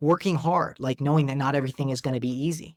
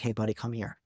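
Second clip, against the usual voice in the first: put-on softer, lowered call to a child; 4 semitones lower, 6 dB quieter than usual. Shown as a demonstration. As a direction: drop the voice to call him over gently. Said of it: "lower my voice to kind of a whisper".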